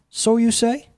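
high, rising doubtful query; skeptical questioning. A narrator's reading. Jesus echoes Death's claim, doubting it. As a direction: throw the boast back as a high question.

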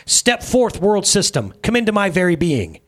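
high, forceful summons; commanding. A narrator's reading. Jesus summons the world system into himself, commanding. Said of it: "I commanded those who were present".

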